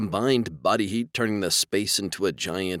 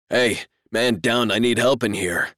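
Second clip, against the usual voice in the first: calling out; 4 semitones higher, 5 dB louder than usual. Acted. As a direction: shout down the hall for help. Calling for help in hallway.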